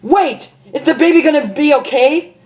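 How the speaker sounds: very high, frantic plea; panicked plea. Acted. Rainbow shaking the doctor, high panicked plea.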